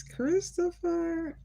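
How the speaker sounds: drawn-out high greeting call; calling out a name. Real, not acted. Streamer calling out to a viewer joining the live. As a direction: call his name across the room, stretched and bright.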